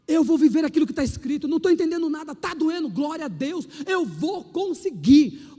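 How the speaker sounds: loud, driving declaration; full-force sermon declaration; fervent preaching. Acted. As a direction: preach it with rising fire.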